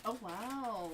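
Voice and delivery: ordinary delivery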